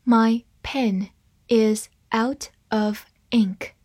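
slow, word by word; deliberate teaching pace, words separated; slow reading. Shown as a demonstration. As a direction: read slowly with a gap after each word. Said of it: "慢慢来读".

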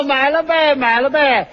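拖腔叫卖; 学小贩招揽买主，字音拉长; 替人吆喝. Acted. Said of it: "还得吆喝". (你来我往地帮对手吆喝西瓜)

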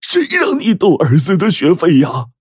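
high, strained cry of rage; uncontrollably furious. A narrator's reading. Husband berates wife over son's tuition, furious. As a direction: explode in a high-pitched, outraged scolding. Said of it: "怒不可遏地说".

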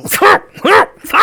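sharp high mouth-made punching noises; loud percussive bursts for each blow; imitated punch sounds. Imitating repeated punches to head.

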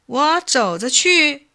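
drawn-out, high-pitched stalling; slow and dawdling. A narrator's reading. Bacang stalls the fuming chief, dawdling. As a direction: drag the words out to stall. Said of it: "又慢吞吞地说".

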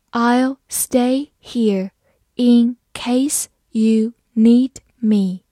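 very slow, word by word; slow reading. Shown as a demonstration. Teacher modelling for students, very slow and clear. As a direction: stretch each word for the learners. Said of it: "好，慢慢来读。".

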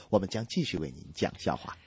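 usual speaking voice

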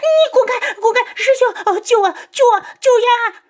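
very high, frantic shrieks; terrified screaming. A narrator's reading. 嫣儿 shrieks to her senior brother, terrified. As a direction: scream for rescue at the top of your pitch. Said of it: "继续尖叫".

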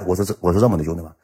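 plain reading voice

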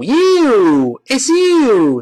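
"You" stressed hard, high, loud and drawn out; full, emphatic vowel on "you"; strong stress. Shown as a demonstration. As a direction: punch and stretch every "you". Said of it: "除非我们特别重读".

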